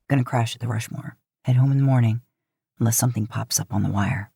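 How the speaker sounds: low brotherly reassurance; Dean's voice. Acted. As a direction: drop low and calm for Dean. Narrator voices Dean.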